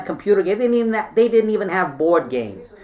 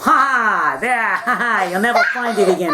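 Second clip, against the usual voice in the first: gloating mock laugh. Napoleon gloats, acted laugh.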